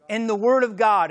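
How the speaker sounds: ordinary delivery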